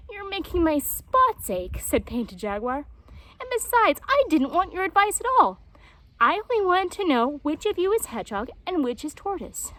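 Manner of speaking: high, loud, exasperated jaguar; Painted Jaguar. A narrator's reading. Jaguar snaps at the animals, high and loud. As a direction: lose patience with them.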